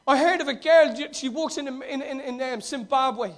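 ordinary talk, nothing marked

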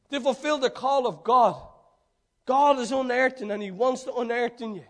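ordinary talk

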